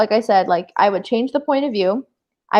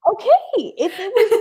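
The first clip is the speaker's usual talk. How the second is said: words broken by laughter, pitched up; laughing while making her point; 10 semitones higher than usual. Real, not acted. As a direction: giggle through it.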